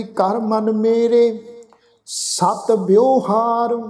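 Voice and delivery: very slow, drawn-out Gurbani recitation; scripture recitation. Acted. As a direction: recite each phrase slowly, holding the long vowels. Recites scripture between explanations.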